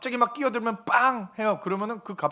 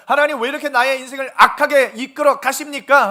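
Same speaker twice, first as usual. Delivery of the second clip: loud, high-pitched mock protest; acted complaint; 5 semitones higher, 9 dB louder than usual. Believer asking God why life goes badly, loudly complaining. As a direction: cry out an imitated grievance to God.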